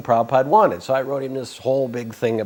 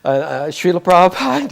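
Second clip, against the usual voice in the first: nervous stammer, a little higher; hesitant, halting opening to the guru; stammering to get the guru's attention; 7 semitones higher, 7 dB louder than usual. Retold or imitated. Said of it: "So I kind of stammer".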